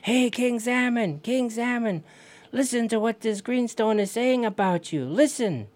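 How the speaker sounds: hailing someone far off; yelling to the salmon. Acted. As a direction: call out across the water, pitched well up. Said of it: "So he yells at the king salmon".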